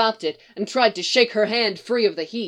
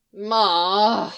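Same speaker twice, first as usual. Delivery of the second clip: whine; 4 dB louder than usual. Acted. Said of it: "a familiar whine".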